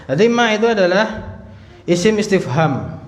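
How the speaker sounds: ordinary talk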